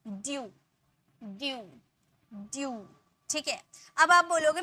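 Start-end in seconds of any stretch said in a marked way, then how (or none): 0.1-2.9 ड्यू, "dyoo", repeated softly with gaps; ड्यू as one gliding syllable; ew as yoo. Shown as a demonstration. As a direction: glide from d through ee into oo on each ड्यू. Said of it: "यू पढ़ते हैं E W को".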